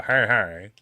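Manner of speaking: imitated catchphrase with a pirate twang; pirate-like greeting. Catchphrase between two friends, pirate-like greeting. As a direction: toss out a mock pirate hello. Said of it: "greet you like a pirate".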